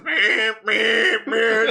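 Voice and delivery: high loud beeping; radio alarm going off, beeping loud; imitated alarm beep. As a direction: mimic the weather radio.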